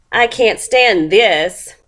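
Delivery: "this" drawn out in slow exasperation; slow right down and lean on "this"; stress on THIS. A narrator's reading. Mister Bear on his wife's snoring, stressing "this". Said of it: "this is capitalized".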